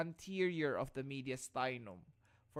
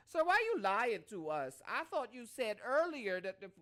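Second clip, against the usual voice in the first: higher, louder mock urban accent; Adrian's urban accent; 6 semitones higher, 4 dB louder than usual. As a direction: challenge the teacher in an imitated urban accent. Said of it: "So, ganun na ganun yung accent".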